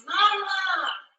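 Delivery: high, long-held call at normal volume; retold yell. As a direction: imitate a drawn-out cry for a mother. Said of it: "someone yells".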